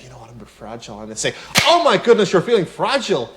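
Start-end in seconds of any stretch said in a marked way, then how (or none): 0.0-1.0 much softer, a touch subdued; fragile and quiet. Retold or imitated. Telling a friend you feel fragile, softly. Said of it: "if you're feeling a little fragile one day". 1.7-3.3 much higher and louder, gushing; mock over-the-top sympathy; overdone excitement. Friend answering fragile news, inappropriately gushing. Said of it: "is that an appropriate tone?".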